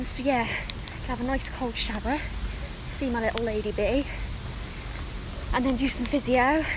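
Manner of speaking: breathless, talking mid-jog; puffing out words between strides; while running. Real, not acted. Talking mid-run, outdoors, loud.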